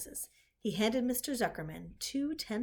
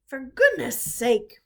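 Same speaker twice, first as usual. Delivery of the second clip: louder, slow exasperated bellow; drenched farmer bellowing at the hired man; 4 semitones higher, 7 dB louder, slower than usual. A narrator's reading. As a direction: bellow it, dripping and annoyed. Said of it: "bellowed Mister Zuckerman".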